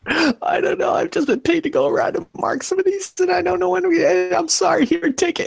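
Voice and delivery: very high panicked babble; frightened apologizing. Acted. Scared worker hands his tool to the intruder, terrified. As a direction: squeak out excuses like a cornered coward. Cowering man answers his interrogator.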